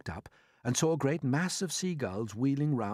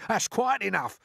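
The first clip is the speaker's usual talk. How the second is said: sharp protest; 10 semitones higher, 6 dB louder than usual. A narrator's reading. Earthworm stopping James, sharply. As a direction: cut him off hard.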